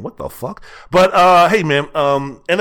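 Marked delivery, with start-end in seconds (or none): 0.0-0.6 softly muttered, much quieter aside. Retold or imitated. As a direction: drop to a hushed mock aside. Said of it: "Sam is looking down from heaven saying".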